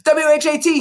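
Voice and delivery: put-on high squeal of a frightened boy. A narrator's reading. Boy held by the ears squealing, very high. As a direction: squeak the letters out in panic. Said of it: "he squealed".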